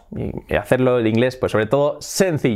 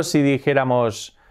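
normal talking voice

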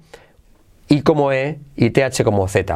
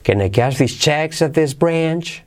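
"checks" and "branch" accented in a question; stress on checks, branch; 3 semitones higher than usual. Shown as a demonstration. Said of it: "Yo diría checks en branch".

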